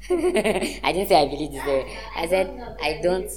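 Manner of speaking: loud, laughing self-correction; laughing protest. Real, not acted. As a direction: object loudly through laughter, drawing it out. Laughing protest at being misquoted.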